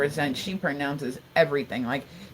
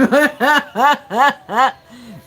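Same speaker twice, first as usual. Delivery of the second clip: big burst of real laughter; helpless laughter after a parody; loud laugh; 7 semitones higher, 9 dB louder than usual. Real, not acted. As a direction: laugh out loud at your own joke. Cracks up at own impression.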